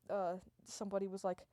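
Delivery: ordinary delivery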